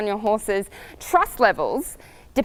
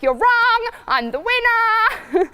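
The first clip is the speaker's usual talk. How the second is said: screaming stallion; 11 semitones higher, much slower than usual. Retold or imitated. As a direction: crow your victory to the crowd. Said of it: "He'd be like".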